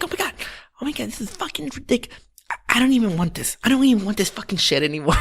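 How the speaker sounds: high, fast hammed-up tantrum; mock exasperation. Customer giving up at the register, high mock-exasperated rant. Fed-up daughter acted out at register.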